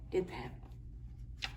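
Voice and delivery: ordinary delivery, nothing marked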